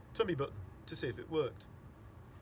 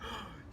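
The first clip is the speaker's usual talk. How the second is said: sharp gasp of excitement; sudden awed intake of breath; amazed gasp; 6 semitones higher, 6 dB quieter than usual. A narrator's reading. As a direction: gasp in astonishment before speaking. Amazed father's intake before speaking.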